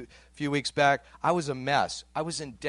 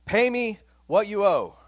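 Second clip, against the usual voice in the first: higher, louder demand read aloud; angry demand; 7 semitones higher, 5 dB louder than usual. A narrator's reading. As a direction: demand payment forcefully, pitch raised. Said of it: "he began to choke him".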